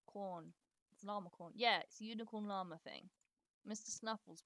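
ordinary talk, nothing marked

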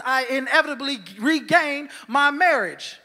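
ordinary talk

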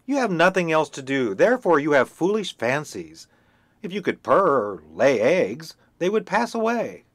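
loud, high lecturing hen; scolding hen. Acted. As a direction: scold the dreamer firmly. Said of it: "hen scolds duckling".

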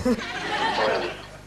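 stifled laugh. Real, not acted. Caller corpses on phone.

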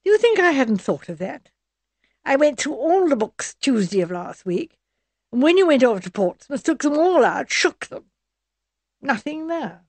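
a touch of scorn, dismissing the suggestion; scornful. A narrator's reading. Charmian dismisses Edward's library idea, scornful. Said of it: "Charmian shook a scornful head".

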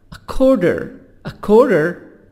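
American "quarter" with tapped T and sounded Rs; American accent. Shown as a demonstration. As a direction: say "kworder" with American Rs.

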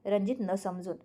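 ordinary delivery

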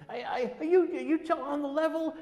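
high-pitched, stammering excitement; thrilled, incredulous retelling; excited. Retold or imitated. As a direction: imitate a friend tripping over words in amazement. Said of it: "He was so excited".

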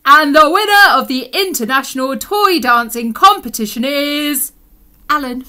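loud, projected announcer voice; competition announcer. Acted. Announcer naming the dancing champion, grand announcement. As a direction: announce the winner with a big build-up.

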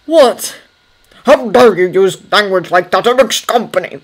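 loud, indignant scolding; thunderous outrage. Acted. Carson rebuking Thomas for swearing in company, thunderous. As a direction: thunder at him in outraged disbelief. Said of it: "his expression thunderous".

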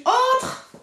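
crié très haut à travers la porte; crie entre. Retold or imitated. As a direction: lance un grand appel aigu vers la porte. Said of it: "je crie". (crie « Entre » très aigu)

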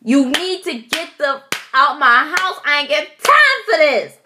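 high, loud furious yell; mad yelling at a bug. Retold or imitated. Scolding a roach in her house, loud and furious. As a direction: blow up at the intruder in your house. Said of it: "I was so mad".

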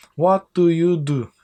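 "What do you do?" stretched slowly, every word distinct; slow and clear. Shown as a demonstration. Teacher slows the question so students catch it. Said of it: "meslek anlamını taşıyor".

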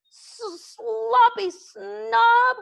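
high, slow, loud stammered taunt; dragon calling out taunt. A narrator's reading. Toothless taunting Fireworm across the sky, high and loud. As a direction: call the insult out across the air. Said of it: "Toothless called out".

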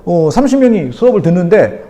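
usual speaking voice

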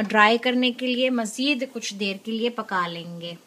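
ordinary talk

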